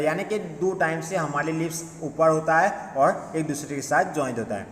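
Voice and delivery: usual speaking voice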